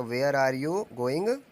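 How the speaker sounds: very slow, word by word for the class; slow dictation. Shown as a demonstration. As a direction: say it slowly so students can write. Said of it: "तुम की वजह से". (धीरे-धीरे वाक्य बोलना)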